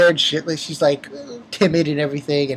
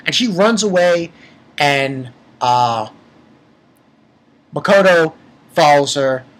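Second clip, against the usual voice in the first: ordinary delivery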